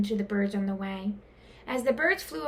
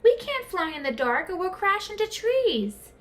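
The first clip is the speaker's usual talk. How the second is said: higher, anxious voice; higher, nervous pleading; worried; 8 semitones higher than usual. A narrator's reading. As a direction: fret about crashing. Scared Stellaluna.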